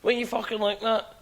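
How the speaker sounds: high, aggressive ned shout; Glasgow daftie. Retold or imitated. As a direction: bark it like a lairy Glasgow youngster. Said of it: "you would see the dafties running about Glasgow".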